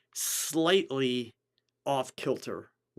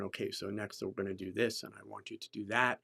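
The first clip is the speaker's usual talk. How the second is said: flat, dull, low-energy instructions; monotone; 4 semitones lower, 7 dB quieter than usual. Shown as a demonstration. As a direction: drone it out like a bored photographer. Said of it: "I'm not talking in a monotone".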